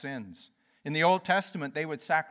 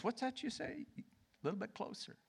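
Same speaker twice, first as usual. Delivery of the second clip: hushed; 7 semitones higher, 13 dB quieter, faster than usual. Acted. Acting God drawing you close.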